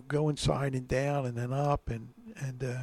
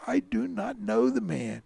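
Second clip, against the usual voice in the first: higher, vehement denial; cursing denial; 7 semitones higher, 4 dB louder than usual. A narrator's reading. Cornered Peter denies Jesus to his accusers, vehemently. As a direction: swear it off with raised pitch. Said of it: "he began to curse and swear".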